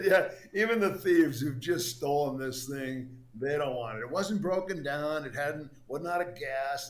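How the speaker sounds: laughing retelling. Retold or imitated. Laughing retelling of stolen car.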